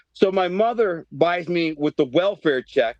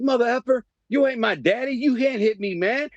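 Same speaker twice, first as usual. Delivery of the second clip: defiant; 7 semitones higher than usual. Retold or imitated. Kid yelling back at principal.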